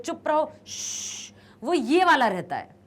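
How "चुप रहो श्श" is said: hushing someone. Retold or imitated. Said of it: "ऐसे कहते हैं".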